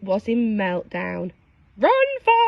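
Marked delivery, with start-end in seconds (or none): 1.8-2.5 high, loud panicked cry; high frightened yelp. Acted. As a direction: squeal it in a panic. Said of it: "panicked Peppa".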